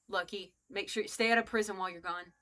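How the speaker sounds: ordinary talk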